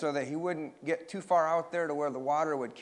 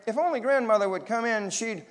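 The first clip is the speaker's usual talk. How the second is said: put-on high, louder boyish exclamation; excited storybook cry; 7 semitones higher, 4 dB louder than usual. A narrator's reading. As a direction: argue it eagerly, pitch raised. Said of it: "cried Larry".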